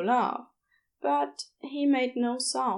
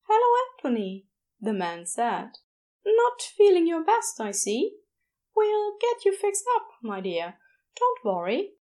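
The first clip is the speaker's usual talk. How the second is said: kindly nurse; 6 semitones higher than usual. A narrator's reading. Nurse Aziraphale greeting injured Crowley, cheerful and soothing. Nurse figure voiced in story.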